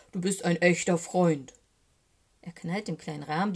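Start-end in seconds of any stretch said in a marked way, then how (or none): 0.1-1.5 louder, emphatic bear voice. A narrator's reading. Eddie Bär praises his friend, loud and emphatic. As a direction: declare it loudly as a bear.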